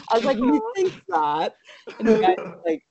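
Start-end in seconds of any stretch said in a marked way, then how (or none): 0.4-1.6 very high, drawn-out mock Irish; bad Irish accent. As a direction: exaggerate a terrible Irish accent, slowly. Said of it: "it really got really bad".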